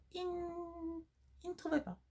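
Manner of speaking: sudden high falsetto squeak; squeaky high voice. Acted.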